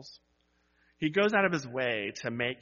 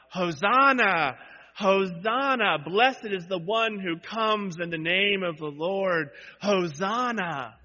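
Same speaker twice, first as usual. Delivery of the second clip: crowd cries; 5 semitones higher, 7 dB louder than usual. Acted. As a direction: cry out like the cheering crowd. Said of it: "with their cries of".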